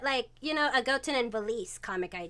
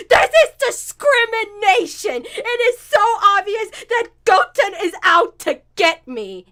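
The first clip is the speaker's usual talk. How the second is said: sore loser whining; 9 semitones higher, 12 dB louder than usual. Acted. Trunks sent to jail in the game, indignant whine. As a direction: protest like a wronged child. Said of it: "being a sore loser".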